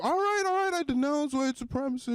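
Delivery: high, exasperated giving-in; grudging mock denial. Boyfriend told to say something, high grudging concession.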